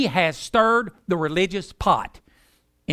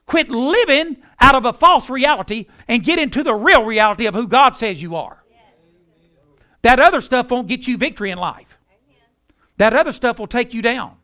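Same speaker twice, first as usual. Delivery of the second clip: louder, higher, driving exhortation; preaching at full force; 4 semitones higher, 5 dB louder than usual. Acted. Preacher urging the congregation, full-force preaching. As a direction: press the congregation hard.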